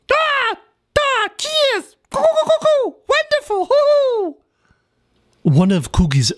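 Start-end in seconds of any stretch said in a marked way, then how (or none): 0.1-4.4 very high, excited bird chatter; jovial kookaburra. Acted. Cookie answers his friend in a squawking bird voice.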